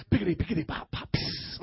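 percussive mouth-noise scatting; mock tongues as beatbox-like scat; playful scat. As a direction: pop out scat syllables, ending in a long hiss. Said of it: "like scat".

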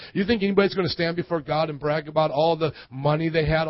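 normal talking voice

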